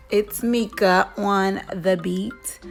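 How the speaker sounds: usual speaking voice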